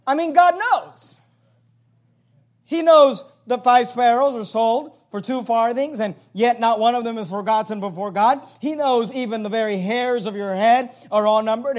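preaching at full force. Acted. Pastor to the church, preaching at full force. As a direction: preach it out to the congregation.

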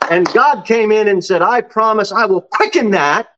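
high, building sermon cadence; emphatic preaching. Acted. Preacher telling the congregation of God's promise to Abraham, emphatic. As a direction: proclaim the promise with rising fervor.

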